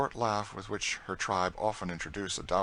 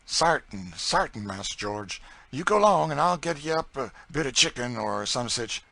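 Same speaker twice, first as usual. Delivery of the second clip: folksy dialect, louder, higher as she goes; fond Southern cook; 5 semitones higher, 5 dB louder than usual. A narrator's reading. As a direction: promise a treat in Southern dialect. Narrator voices Chloe to George.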